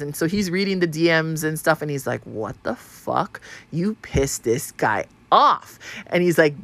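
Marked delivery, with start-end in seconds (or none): 2.2-5.8 low, drawn-out disbelief; stunned husband. Retold or imitated. Husband reading the DMs to his wife, low and slow in disbelief. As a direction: imitate a husband slowly taking it in, word by word.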